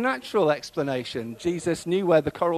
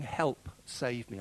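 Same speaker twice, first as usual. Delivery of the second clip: slow, softer plea; inward thought; 6 dB quieter, much slower than usual. Retold or imitated. Worshippers sitting there silently needing saving, quiet inward plea. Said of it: "have sat there thinking".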